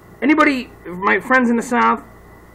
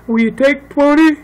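lightly put-on southern buyer, pitched up; southern accent; 6 semitones higher, 4 dB louder than usual. Buyer haggles over an eighty-buck price tag, southern accent. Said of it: "why do I say that in a southern accent".

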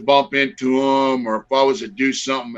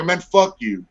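retold angry retort; 5 semitones higher, slower than usual. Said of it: "I was mad".

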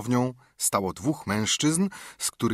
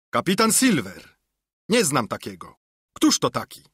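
higher, louder scornful cry; indignant call; 3 semitones higher, 4 dB louder than usual. A narrator's reading. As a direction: call back in disbelief. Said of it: "zawołał kapitan". (wyższe, głośniejsze, oburzone wołanie)

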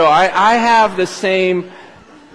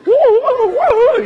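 falsetto party woo; high excited whoops; 3 dB louder, much slower than usual. Retold or imitated. Worshippers in Australia whooping, very high and excited. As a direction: whoop way up high like a dancing crowd. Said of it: "it's just".

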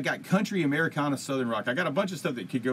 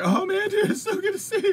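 very high, loud squeal of delight; gushing reunion greeting; 6 dB louder than usual. Retold or imitated. Retelling a hug with his long-unseen friend, squealing delight. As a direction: re-enact an overjoyed hello, hammed up and squeaky. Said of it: "It was like that".

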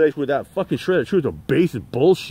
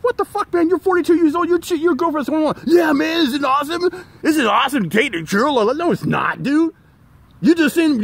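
high, disbelieving mock lecture to a friend; a pitched-up retold scolding; incredulous retort; 11 semitones higher than usual. Telling his coworker off for dating a twenty-one-year-old, incredulous.

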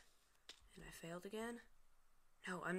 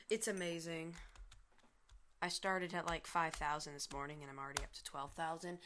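normal talking voice

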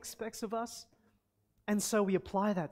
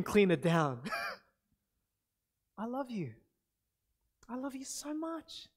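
tender parent. Acted. Parent reassuring frightened daughter.

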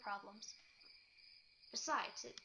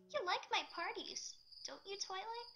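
higher, pleading Pinkie voice; desperate question; 8 semitones higher than usual. A narrator's reading. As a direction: ask it as if everything hangs on the answer. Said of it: "the desperation behind the unexpected question".

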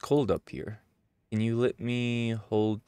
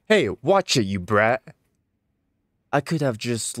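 angry rebuke; 6 dB louder than usual. Acted. Adult warns the child who insulted him, angry. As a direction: put the brat in place. Adult snaps back at kid.

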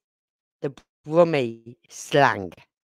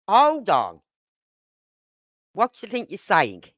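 firm, higher-pitched challenge; acted indignant confrontation; speaking up; 4 semitones higher than usual. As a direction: pull them up sharply on it. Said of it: "who would openly speak up".

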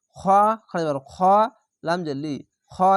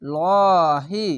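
extremely slow heavy লহি with a ringing hold; sung Arabic recitation; Quran recitation; 5 dB louder, much slower than usual. Shown as a demonstration. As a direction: draw লহি out for several beats. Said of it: "শেষ অক্ষরে সাকিন দিয়ে দিলাম".

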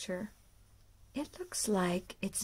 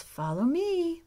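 a touch higher and louder; lightly voiced mommy pig; 7 semitones higher, 7 dB louder than usual. Mommy pig telling piglets to follow, light voice. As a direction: call the piglets along gently. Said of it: "which I think means".

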